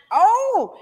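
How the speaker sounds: sudden high oh of surprise; startled realisation; surprised oh. Real, not acted. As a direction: jump up in pitch, caught off guard. Hearing about the Packers.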